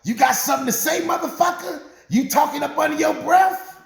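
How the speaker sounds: high-pitched, angry challenge; confrontational wife. Retold or imitated. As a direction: call him out as the furious wife. Wife confronting mumbling husband.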